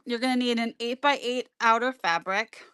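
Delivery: talking around a mint; words round a mint in the mouth; mouth full. Real, not acted. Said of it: "I have a mint in my mouth right now".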